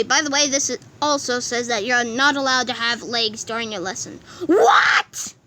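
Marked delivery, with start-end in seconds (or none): 4.5-5.3 high loud shocked yelp; shocked cry. Acted. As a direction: yelp in disbelief. Shocked at no legs.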